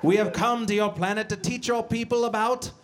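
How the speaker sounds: high, slow fifties sci-fi alien; old speech synthesizer. Acted. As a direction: announce it like a movie alien. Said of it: "sounds like a character from a, like, a fifties science fiction movie".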